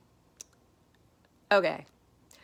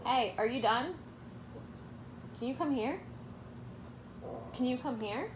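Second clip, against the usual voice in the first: coaxing the dog; 7 semitones higher, 8 dB quieter, faster than usual. Real, not acted. Talking to the dog.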